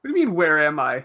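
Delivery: confused son. Acted. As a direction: mimic a confused kid challenging Mom. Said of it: "a confused son in Spanish class".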